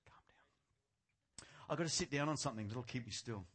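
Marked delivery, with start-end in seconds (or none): 0.1-1.5 barely audible whisper to oneself; self-calming whisper. Real, not acted. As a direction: whisper it to settle yourself. Preacher settling himself after shouting.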